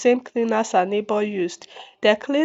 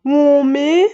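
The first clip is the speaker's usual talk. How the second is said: screamed name; 4 semitones higher, 5 dB louder than usual. A narrator's reading. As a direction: call the name out higher and louder. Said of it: "She apologized and screamed".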